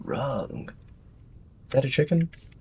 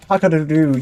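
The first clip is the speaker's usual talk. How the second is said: loud cock-a-doodle-doo call; rooster crow imitation; 5 semitones higher, 6 dB louder, faster than usual. Imitating animal sounds for chat.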